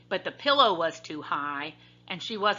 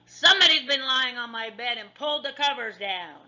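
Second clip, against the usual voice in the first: big, higher-pitched growling bear voice; father bear growl; 5 semitones higher, 4 dB louder than usual. A narrator's reading. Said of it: "he growled in his great big voice".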